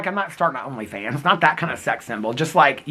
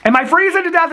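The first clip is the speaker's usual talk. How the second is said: higher, louder mock alarm; hammed-up panic about freezing, pitched up and loud; 11 semitones higher, 5 dB louder than usual. Joking about freezing, asks twice.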